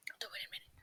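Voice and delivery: unvoiced whisper under the breath; whispered aside. Real, not acted. Muttered aside during the recording.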